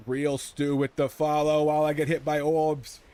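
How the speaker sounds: slipped New England vowels, loud and slow; a Boston-ish slip, drawn out; New England twang slipping out; New England accent. Real, not acted. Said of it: "did my New England accent come out there".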